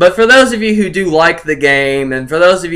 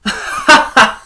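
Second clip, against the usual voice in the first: high burst of laughter; 11 semitones higher than usual. Real, not acted. Laughs at pricing.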